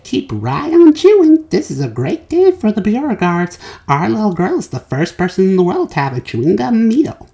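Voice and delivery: a hint of a booming proud father. A narrator's reading. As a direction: boast about your daughter. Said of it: "said Mister Beauregard".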